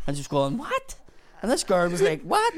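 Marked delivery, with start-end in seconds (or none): 0.6-1.0 squeaked, much higher "What?"; a startled woman's squeal; shocked. Retold or imitated. Customer reacting to a stranger in her house: shocked squeal. 2.2-2.5 squeaked, much higher "What?"; a startled woman's squeal; shocked. Retold or imitated. Customer reacting to a stranger in her house: shocked squeal.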